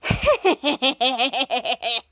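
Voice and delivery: imitated smug little laugh; lightly voiced gleeful snicker; toddler's laugh. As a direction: chuckle like a sneaky kid. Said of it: "little corner and said".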